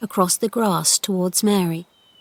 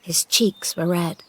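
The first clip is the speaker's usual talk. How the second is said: ordinary delivery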